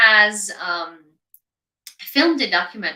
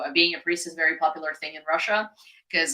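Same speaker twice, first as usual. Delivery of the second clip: ordinary delivery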